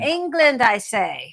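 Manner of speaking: light mock British "I say". Wife answering husband in mock British. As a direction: toss off a hint of a British accent.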